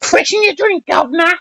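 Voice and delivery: very high-pitched English caricature; mock English accent. Mocking the English about their accents, in a high squeaky English accent. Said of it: "I got that out of The Simpsons".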